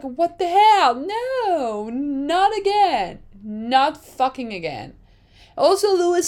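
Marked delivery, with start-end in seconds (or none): 0.0-4.9 high, shocked outcry; higher voice, can't believe it; dismayed disbelief. Retold or imitated. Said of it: "I was like".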